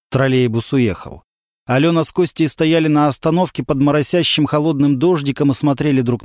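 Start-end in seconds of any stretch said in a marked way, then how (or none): none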